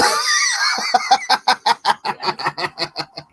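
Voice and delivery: high shouted laugh. Real, not acted. Laughing at space bubbles.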